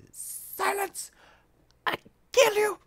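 high, loud furious villain shriek; angry enemy voice. Acted. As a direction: screech it like an enraged foe. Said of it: "Enrique's pissed now".

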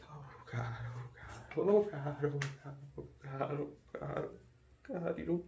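whispered, unravelling repetition; desperate whisper. Acted. Captor whispers to himself, coming undone. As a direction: mutter it like a prayer falling apart.

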